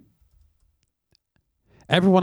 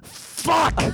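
high, strained mock scream; retold yelled curse; 4 dB quieter than usual. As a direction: let out a drawn-out cry of dismay. Retold horrified discovery at home.

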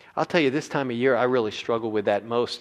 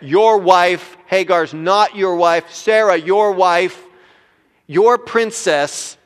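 "Your wife" and "not" hit slow and loud; emphasized your wife; 6 semitones higher, 11 dB louder, much slower than usual. Acted. God correcting Abraham, slow loud stress on "your wife". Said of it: "how he emphasizes that".

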